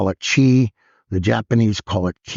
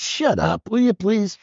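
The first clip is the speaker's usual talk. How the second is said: high-pitched, lightly voiced scolding; mock high-pitched reproach; retold telling-off; 12 semitones higher than usual. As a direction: shush them in a high, pleading voice. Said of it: "She says".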